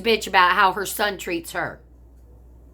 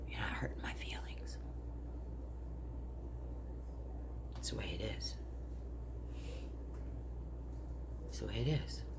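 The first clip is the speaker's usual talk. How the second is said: breathy whispered resignation; hushed, defeated whisper; 9 semitones lower, 19 dB quieter than usual. Real, not acted. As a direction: drop to a bitter whisper. Bitter resigned vent to family.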